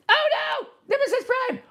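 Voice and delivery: high squealing toy gasp; mock alarm. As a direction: cry out like a panicked plush.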